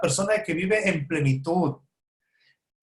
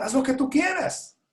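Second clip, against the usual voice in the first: high-pitched punchline; 7 semitones higher than usual. Acted. Repeats the punchline, higher.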